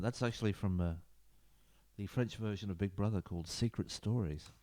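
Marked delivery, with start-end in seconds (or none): none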